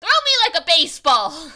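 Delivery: high, loud eager shout; hammed-up call-out. Player imagining him asking to be thrown, hammed-up shout. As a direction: holler it like a ridiculous request. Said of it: "he's like".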